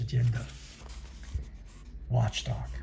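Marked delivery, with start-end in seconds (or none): none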